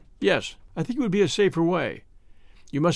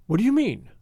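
a raised, eager question, voiced rather than whispered; excited whisper; 6 semitones higher than usual. A narrator's reading. Brigand pressing his jailer about the plan, excited. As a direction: lean in, hungry to know. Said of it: "in an excited whisper".

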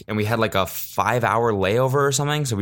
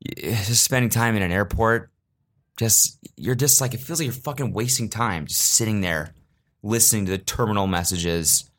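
normal talking voice

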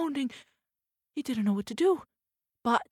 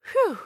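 relieved sigh; 12 semitones higher, 8 dB louder than usual. Acted. Relief again.

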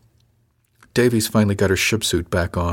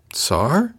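higher, rising plea; pleading; 6 semitones higher than usual. A narrator's reading. Crew member appealing to the officer, pleading. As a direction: lift it into a pleading question. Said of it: "she pleaded".

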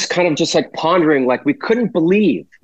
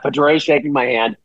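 normal talking voice

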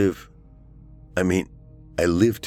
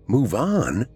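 "Move on?" pitched up, then falling on "on"; indignant repeat; 6 semitones higher than usual. A narrator's reading. Eli repeats her suggestion, "Move on?" falling, indignant. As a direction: throw "Move on?" back with a falling, emphatic end.

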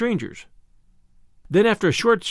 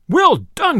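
high, loud joyful praise; joyous cry; 8 semitones higher, 5 dB louder than usual. A narrator's reading. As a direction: cheer the archer with delight. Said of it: "cried the sheriff, right joyously".

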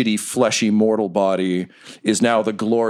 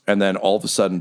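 usual speaking voice

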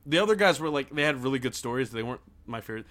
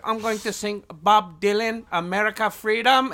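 imitated thick Nepali accent, higher and louder; slow, high-pitched imitation of a Nepali accent; mock Nepali accent; 8 semitones higher, 6 dB louder than usual. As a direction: do a hammed-up Nepali-accented English. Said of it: "like, crazy accent, right?".

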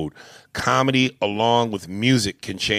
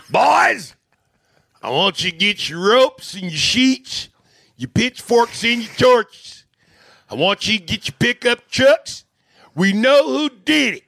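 rallying mob leader; 13 semitones higher, 5 dB louder than usual. Acted. Daddy rallies the lynch mob.

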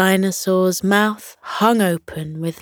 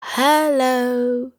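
high, sweet little dinosaur hello; higher little creature calling out; 7 semitones higher than usual. A narrator's reading. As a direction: call out sweetly to new friends. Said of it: "called out in a sweet voice".

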